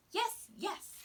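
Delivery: put-on a boy promising fast; eager promise. A narrator's reading. Little boy promising to obey, eager. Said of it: "the little boy promised".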